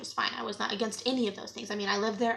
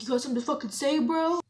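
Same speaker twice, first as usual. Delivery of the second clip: aggressive; 5 semitones higher, 4 dB louder, faster than usual. Retold or imitated. Aggressive housemate confronting Eric.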